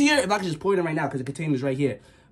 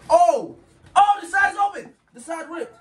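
much higher panicked oh; panicked shout; 11 semitones higher, slower than usual. Real, not acted. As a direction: yell in panic as it breaks. Container ripped, panic.

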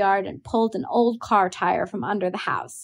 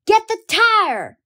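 slow, high, loud holler; 13 semitones higher, 7 dB louder, slower than usual. A narrator's reading. Boy hollering at his sister to fetch the tire, high and loud. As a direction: bellow the order across the yard. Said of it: "Jem hollered".

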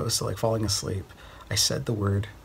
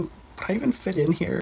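"would I even fit in here?" rising much higher in self-doubt; doubtful question; 10 semitones higher than usual. Retold or imitated. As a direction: squeak the question up high, unsure of himself. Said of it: "And I told them".

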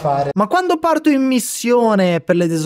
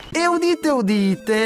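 high, ringing udite, then settling; town crier proclamation; 10 semitones higher than usual. Acted. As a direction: announce it like a herald. Mock proclamation of success.

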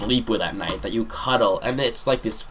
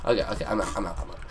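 ordinary talk, nothing marked

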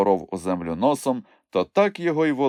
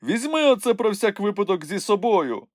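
high-pitched character call; urgent advice; 12 semitones higher than usual. A narrator's reading. Nyukhmumryk hands Moomintroll a knife, urgent advice.

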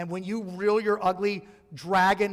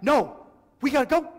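high, louder little-dog voice, urgent; voicing the scared dog; 7 semitones higher, 5 dB louder than usual. Small dog begging owner to leave, in a high urgent voice. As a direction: squeak it out like a panicked small dog. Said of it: "And he's like".

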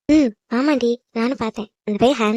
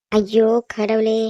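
slow, drawn-out weary groan of words; exasperated; 3 semitones lower, much slower than usual. Acted. Sleepy sister fed up with the crying, exasperated.

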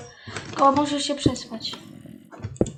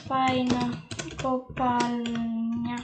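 slow, softer, stretched syllables; drawn-out words; 2 semitones lower, 6 dB quieter, much slower than usual. Real, not acted. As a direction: stretch it out as if typing along. Spelling out waypoint name while typing. (powoli, przeciągając sylaby)

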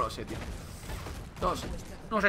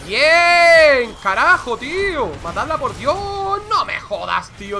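high, loud cheer then urging the kill; excited cheering; 6 semitones higher, 8 dB louder, slower than usual. Real, not acted. Streamer urging teammates in a fight, loud excited cheer. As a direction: shout at the team to finish her.

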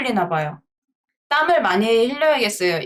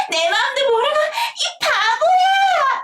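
much higher, slow, half-laughing mock shout; girl's cry; slower than usual. Girl throwing a stone at the boy, laughing mock wail. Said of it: "여자애니까 키 낮출게요".

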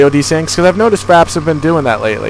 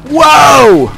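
high, loud cry of surprise; real startled shout; startled yell; 7 dB louder than usual. Real, not acted. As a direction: yelp out in sudden shock. Startled during gameplay.

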